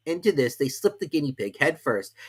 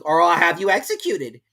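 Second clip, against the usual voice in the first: threatening king; 7 semitones higher, 9 dB louder than usual. A narrator's reading. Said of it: "remarked the king".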